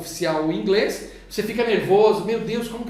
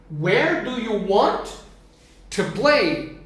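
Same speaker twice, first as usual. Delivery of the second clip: slow, drawn-out English model; much slower than usual. Shown as a demonstration. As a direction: say "Where do you want to play" slowly for students. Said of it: "teacher builds the question slowly".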